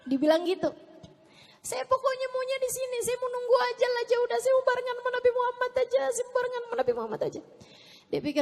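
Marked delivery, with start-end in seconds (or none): 1.6-7.4 high, hurried mock plea; insistent pleading. Old man begging to stay with the Prophet, high insistent plea. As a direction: rattle it out high, refusing to budge.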